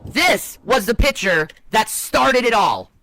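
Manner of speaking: slow, high-pitched announcement; slow showy build-up; dramatic reveal. Real, not acted. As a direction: unveil it with weighty, spaced-out emphasis.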